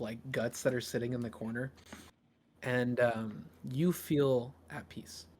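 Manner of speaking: usual speaking voice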